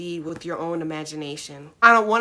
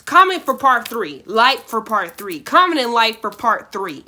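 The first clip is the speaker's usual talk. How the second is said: louder, energetic appeal; loud call to action; 8 dB louder than usual. Real, not acted. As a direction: pitch it to viewers.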